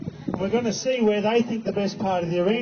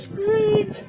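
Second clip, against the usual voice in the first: high-pitched excited mare squeal; sudden high little squeal; high squeak; 12 semitones higher than usual. Retold or imitated. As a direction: squeak like a preening mare at her mirror. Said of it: "she goes".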